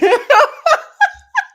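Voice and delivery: squealing laugh; high burst of laughter. Real, not acted. Laughing at Martin quote.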